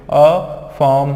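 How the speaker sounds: अफर्म very slow, a stop after अ; syllable break. Shown as a demonstration. Teacher demonstrates the stoppage in the word, syllable break. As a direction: stretch अफर्म into two parts, no र. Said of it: "यहाँ पे एक stoppage ले लेते हो".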